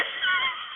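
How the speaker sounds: high squealing laugh; burst of high laughter. Real, not acted. Mom cracking up at her son's joke, squealing laugh. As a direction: break into helpless laughter. She nearly died laughing.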